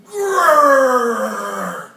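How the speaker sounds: loud growling rhinoceros; rhino growl. Acted. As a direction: growl like a big beast. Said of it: "said the rhinoceros".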